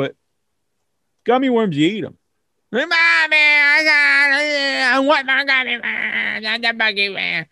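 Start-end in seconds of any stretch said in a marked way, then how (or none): 2.9-7.5 put-on whiny kid. Kid asking mom for more gummy worms, whiny. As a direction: whine for more candy.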